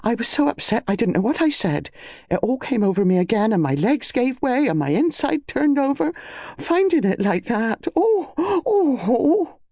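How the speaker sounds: high-pitched, distraught maid's wail; gasped out. Acted. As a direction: gasp it out, rising into wailing ohs. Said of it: "Mary gasped out".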